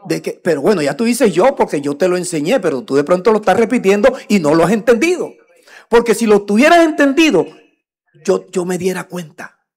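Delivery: loud, high preaching rebuke; pulpit scolding. Acted. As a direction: call them out from the pulpit.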